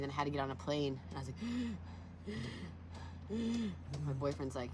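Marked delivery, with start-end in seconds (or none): 1.5-4.0 imitated laboured breathing; struggling gasps. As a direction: wheeze in short strained gasps. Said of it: "and I couldn't breathe".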